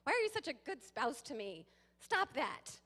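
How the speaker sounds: hammed-up nitpicking complaint; mock annoyance. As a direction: pretend to snap at a perfect spouse. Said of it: "You're like".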